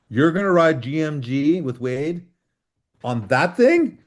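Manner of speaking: imitating an incredulous mechanic, rising on "that thing"; acted-out incredulous question; retold disbelief. Mechanic doubts his bike for trail.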